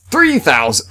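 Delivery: high, loud cry of disbelief at the price; yelling a shocking price aloud; shocked outburst. Real, not acted. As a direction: blurt the price out in real shock. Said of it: "I think I just had a miniature heart attack".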